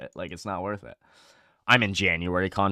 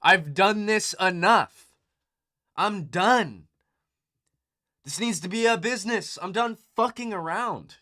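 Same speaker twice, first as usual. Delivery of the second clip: much higher and louder, a fed-up cry; fed-up outburst; 11 semitones higher, 6 dB louder, slower than usual. Retold or imitated. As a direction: shout it like you've finally had enough. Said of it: "you say to yourself".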